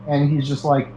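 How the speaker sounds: usual speaking voice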